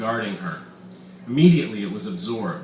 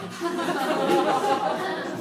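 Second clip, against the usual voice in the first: high jeering laugh; mocking laughter. Crowd laughing at the wave idea, high jeering. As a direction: laugh at him.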